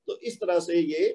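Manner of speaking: ordinary delivery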